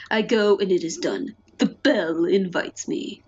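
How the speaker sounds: suddenly dropping into a low movie-narrator voice; Michael Bay narrator. Acted. Player reading the murder plan, low dramatic narrator voice. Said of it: "my Michael Bay narrator voice".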